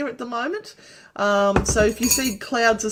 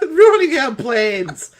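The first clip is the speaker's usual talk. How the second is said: mock outrage; 4 semitones higher, 11 dB louder, slower than usual. Mock complaint, slow and loud.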